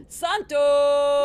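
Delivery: grand announcement. Acted. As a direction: build up and belt the name. Bailiff announces the judge grandly.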